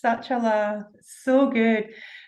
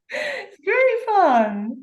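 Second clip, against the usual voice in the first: laughing, drawn out and high; laughing through the words; slow, high giggling delivery; 9 semitones higher, much slower than usual. Real, not acted. Laughing after her demo.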